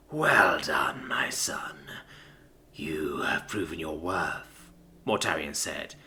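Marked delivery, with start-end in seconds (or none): 0.1-4.7 low slow whisper, softly ominous; calm menace. Acted. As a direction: calmly deliver a deadly order. Voices Mortarion.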